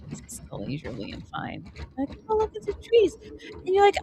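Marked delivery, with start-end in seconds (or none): 2.3-3.2 high-pitched sightseer's coo; put-on wonder at the scenery; mock admiration. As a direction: point it out in a high, dreamy voice. Acting out rider admiring scenery.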